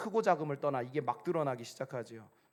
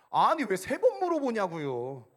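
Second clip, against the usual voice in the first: higher, louder, drawn-out protest; acted burst of exasperation at being asked; exasperated retort; 7 semitones higher, 7 dB louder, slower than usual. Son fed up with father's repeated question, exasperated. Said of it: "그래서 내가 그때 못 참고".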